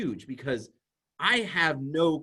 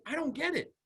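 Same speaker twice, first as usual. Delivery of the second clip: puzzled vendor; 12 semitones higher, 3 dB quieter than usual. Pretend.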